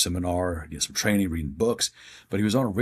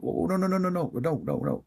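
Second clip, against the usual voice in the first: high, insistent string of no's that fades softer; pitched-up rapid denial trailing off; emphatic no; 8 semitones higher, 3 dB quieter than usual. Real, not acted. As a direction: shoot the idea down, no after no. Rejecting blame of patients.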